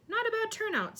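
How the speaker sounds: high, proud boast. A narrator's reading. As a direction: puff up with pride.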